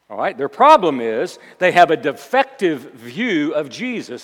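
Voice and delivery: loud, punched sermon delivery; emphatic pulpit proclamation; forceful preaching. Acted. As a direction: drive the point home to the congregation.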